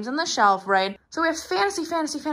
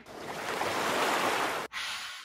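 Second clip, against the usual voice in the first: long airy exhale; winded sigh; 4 dB quieter than usual. Real, not acted. Out of breath already, long winded sigh. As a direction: blow out a long, tired breath. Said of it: "now I'm out of breath already".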